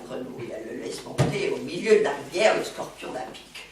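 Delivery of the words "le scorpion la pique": ordinary delivery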